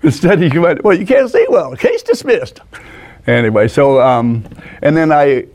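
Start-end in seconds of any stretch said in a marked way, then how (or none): 0.8-2.6 high-pitched mock judge; high put-on voice of a judge; retold judge's ruling. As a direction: imitate a judge tossing out a case, pitched up. Retold judge dismisses case instead.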